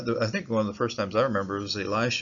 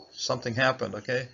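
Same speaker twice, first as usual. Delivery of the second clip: ordinary talk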